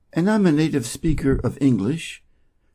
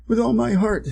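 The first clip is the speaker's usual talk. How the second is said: eager cock voice; 10 semitones higher than usual. Acted.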